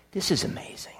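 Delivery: hushed, breathless whisper of wonder; awed whisper. Real, not acted. Preacher awed before the congregation, whispering. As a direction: whisper it as if struck by awe.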